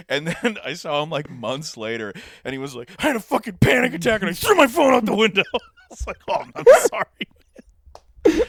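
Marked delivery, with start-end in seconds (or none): 3.0-5.4 mock yell. Friend retells panic attack. 5.5-8.4 laughing. Real, not acted. Apologises while laughing hard.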